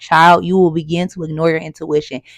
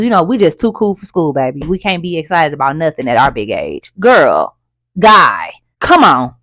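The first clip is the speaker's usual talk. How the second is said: ordinary talk, nothing marked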